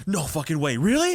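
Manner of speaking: mock amazement that jumps up at the end; retold burst of excited disbelief. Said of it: "Dude got so excited".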